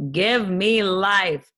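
drawn-out plea. Real, not acted. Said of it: "craving pizza".

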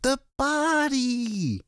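very high, drawn-out cry; high, stretched scream of horror; desperate scream. A narrator's reading. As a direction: scream it slowly in panic. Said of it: "Lois screamed".

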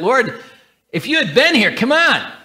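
half-exasperated cry of Lord, come on; complaining plea. Acted. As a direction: let the frustration spill out at God. Voicing Martha's complaint to Jesus.

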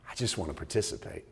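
soft, hushed "I just wanna participate"; quiet plea. Real, not acted. Preacher quietly confessing his wish to God, hushed. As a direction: say it almost to yourself.

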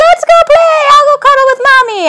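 high, sweet puppy voice; high cheerful dog voice full of love; happy puppy voice. Retold or imitated. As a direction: voice the goofy friendly puppy, up high. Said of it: "Dewey's like".

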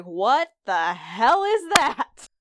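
slow, stunned demand, voice changed; mock horror at a bite mark; a hammed-up wife's outrage, drawn out; shocked Claire. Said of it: "and she's like".

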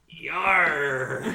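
long, drawn-out "yar"; pirate yar. Acted. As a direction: let the "yar" swell out loud. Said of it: "so I look at it like".

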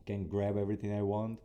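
usual speaking voice

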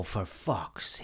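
low, slow, muted exasperation; bemused. Retold or imitated. Comic imagining watching someone else's act, bemused and quiet. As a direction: mutter it in quiet disbelief. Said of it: "like, bemused".

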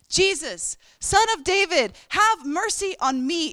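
high, loud desperate cry. Retold or imitated. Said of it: "he starts shouting all the more".

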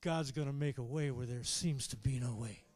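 low, settled reassurance; at peace. Acted. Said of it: "all of a sudden you got peace".

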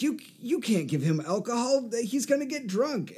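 high-pitched worried protest; high, whiny mock alarm. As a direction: imitate a fretting objector, voice way up. Acting out JD's protest in Scrubs.

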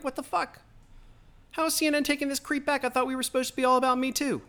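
mock outraged friends. Host mimicking friends on the left, high mock outrage. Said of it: "and they're like".

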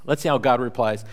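normal talking voice

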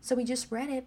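ordinary talk

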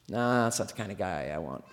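low, soft dismissive mumble; unimpressed moose. Retold or imitated. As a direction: voice a big animal's indifferent thought, deep and quiet. Voices the moose's dismissive thought.

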